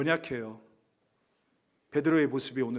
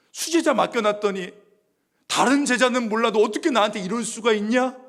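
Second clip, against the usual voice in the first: higher, louder hurt accusation; pretend rebuke; 6 semitones higher, 6 dB louder than usual. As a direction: scold the betrayer, voice rising. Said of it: "저는 아마 그랬을 것 같아요". (배신한 제자를 높고 크게 꾸짖는 흉내)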